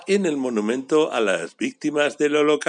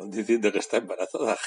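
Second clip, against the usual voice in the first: ordinary delivery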